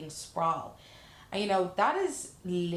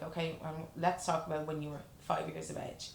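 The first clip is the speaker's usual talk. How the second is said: therapist reply; 5 dB quieter than usual. Retold or imitated. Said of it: "I'm like".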